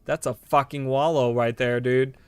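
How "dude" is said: ordinary delivery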